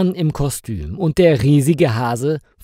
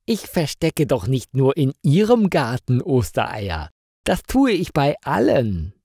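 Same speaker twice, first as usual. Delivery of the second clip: ordinary delivery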